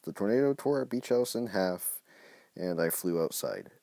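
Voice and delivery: put-on narrating his kid-written story with drama; story narration. A narrator's reading. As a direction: read the escape like an action tale. Reading his old story aloud.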